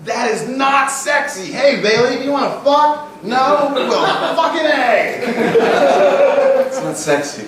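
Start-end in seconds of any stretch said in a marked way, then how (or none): 0.0-1.5 high, punched seminar projection; motivational-speaker voice. Shown as a demonstration. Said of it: "used to speaking to everyone like this". 1.5-6.6 comically high, booming seminar shout; loud motivational voice. Acted. Coach parodies asking Bailey for sex, loud motivational voice. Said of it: "used to speaking to everyone like this".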